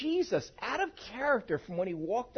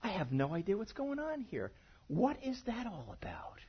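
hushed, baffled whisper to himself; a quiet mutter of total confusion; puzzled whisper; 9 dB quieter than usual. Retold or imitated. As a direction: breathe it out, lost and confused. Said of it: "just like I'm doing with you right now".

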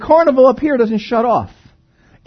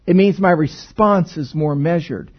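ordinary talk, nothing marked

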